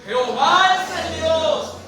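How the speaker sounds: loud, high, drawn-out declaration; triumphant proclamation. Acted. The people fall down and declare God, slow loud cry. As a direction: cry it out slowly to the heavens.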